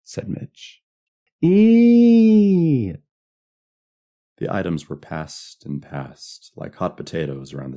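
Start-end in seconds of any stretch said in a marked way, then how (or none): 1.4-3.0 long, loud, high "ee" shriek; piercingly high sustained squeal; children's squeal. A narrator's reading. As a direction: squeal with delighted fright. Children squealing at the witch game.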